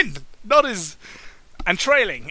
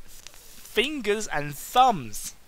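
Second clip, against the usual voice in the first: very slow, stretched repeat; slow playful repeat; drawn out; 5 semitones higher, much slower than usual. Acted. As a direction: savour each word slowly.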